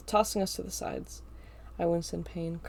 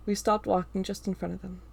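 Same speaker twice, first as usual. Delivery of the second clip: ordinary delivery, nothing marked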